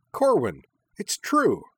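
high, loud, delighted exclamation; cheerful cry. A narrator's reading. Minami greeting Corwin with a jubilant hug, cheerful cry. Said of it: "cried Minami cheerfully".

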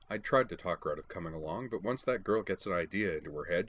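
usual speaking voice

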